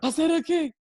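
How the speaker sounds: acted-out worried question; anxious check. Scanning room for the coach.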